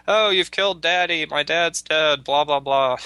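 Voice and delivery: high, whiny put-on sob of a grieving daughter; mock tearful daughter. Daughter to her buffalo husband, mock tearful wail. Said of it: "she's crying and upset".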